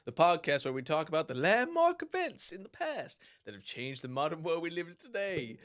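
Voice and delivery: hammed-up old-time American announcer accent, stretched and swooping; kooky American accent. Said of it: "why I'm doing this kooky accent".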